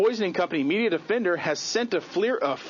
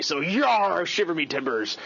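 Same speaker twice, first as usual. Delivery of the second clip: pirate voice; slower than usual. Acted. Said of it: "today is Talk Like a Pirate Day".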